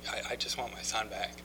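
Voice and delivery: soft, understated plea; quiet retold plea. Tearful father tells the speaker what he wants, quiet plea.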